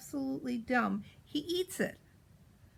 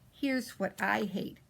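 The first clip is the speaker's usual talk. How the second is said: ordinary delivery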